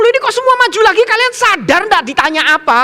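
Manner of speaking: high, loud, rapid questioning; astonished, pressing the crowd for an answer; incredulous challenge. Retold or imitated. Preacher questioning pupils who all came forward, incredulous.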